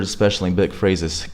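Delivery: ordinary talk, nothing marked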